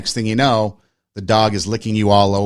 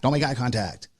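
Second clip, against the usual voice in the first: repeated much softer, under the breath; softer echo of the thought; quiet repeat; 7 dB quieter than usual. Retold or imitated. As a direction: drop the level as if to yourself. Inner voice avoiding the homeless.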